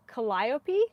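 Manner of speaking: Calliope as kuh-LIE-oh-pee, rising into a question; accent on LIE. Real, not acted. Knitter checking with viewers, Calliope questioningly as kuh-LIE-oh-pee. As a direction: try out Calliope stressed on the second syllable. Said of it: "The accent is on the lie".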